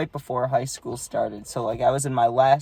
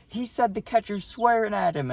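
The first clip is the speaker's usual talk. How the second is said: high, strained mock yell without volume; imitated yelling coach; 9 semitones higher than usual. Opposing coach complaining to the umpire, mock yelling. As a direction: pitch it up like an outraged coach. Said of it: "the coach is yelling".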